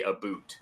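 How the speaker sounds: "a boot" for "about", Canadian vowel, low and soft; Canadian pronunciation. Shown as a demonstration. Admitting his own accent slip, "a boot" with a Canadian vowel. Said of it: "I say".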